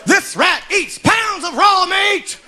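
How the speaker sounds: hammed-up midway barker shouting the pitch; barker spiel. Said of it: "And he kept going".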